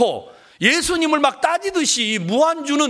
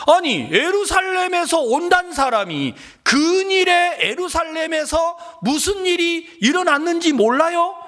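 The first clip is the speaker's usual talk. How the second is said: confrontational questioning; 6 semitones higher than usual. Retold or imitated. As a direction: press him, stretching the words indignantly. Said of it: "예수님에게 따지듯이 말하잖아요". (따지듯이 높게 언성을 높여서)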